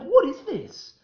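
high-pitched mock astonishment; acted bafflement. Acting out his own bafflement.

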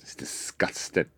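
slow scandalised whisper; hushed disgust. Retold or imitated. Aunt gossiping about the neighbour, whispered. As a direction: breathe it like juicy gossip. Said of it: "She went".

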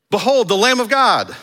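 high, loud announcing call; loud proclamation. Acted. John sees Jesus again and calls out, loud proclamation. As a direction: herald it to the whole crowd.

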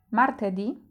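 Martedì said mar-teh-DEE, stress on the final ì; Martedì with the stress on the last vowel; Italian pronunciation. Shown as a demonstration. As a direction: clear teacher model of Martedì. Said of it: "Staraj się powtarzać po mnie".

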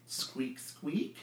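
soft, high questioning squeaks; whispery little rodent squeaks; hamster squeaks. Acted. Boo the hamster replying to Minsk, squeaking softly.

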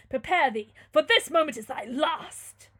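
loud, high, drawn-out threat; menacing death sentence. Acted. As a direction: pronounce doom in a theatrical boom. Tyrant announces the youth's death.

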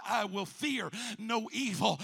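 ordinary delivery

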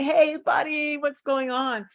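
relaxed chummy hello; casual buddy greeting. Shown as a demonstration. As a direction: loosen up and say hi. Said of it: "You don't get to just say".

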